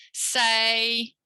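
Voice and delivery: "Say" held long on a level note; teacher's sustained "Say"; model syllable. Shown as a demonstration. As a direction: draw out "Say" clearly for copying. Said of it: "I'm gonna say it and you imitate me".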